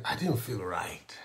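breathy hushed whisper, low. Retold or imitated. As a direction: confide it under the breath. Retelling feeling unwell, whispered.

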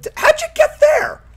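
high shocked yell; surprised shout. Real, not acted. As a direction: shout it in disbelief. Companion appears far ahead.

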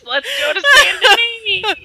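slow, high laughing announcement. Real, not acted. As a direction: drawl it out through laughter. Laughing hype for plan B.

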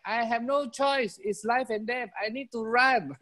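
high-pitched earnest desperation; desperate rabbit. Acted. Rabbit says life and death.

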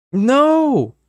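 put-on high startled child's cry; frightened cry. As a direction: yelp in fright. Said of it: "a hushed cry of protest".

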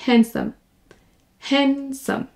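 Handsome said as hansome, no D sound; Handsome, drop the D completely; silent D. Shown as a demonstration. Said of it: "on ne prononce pas le D". (Handsome sans prononcer le D)

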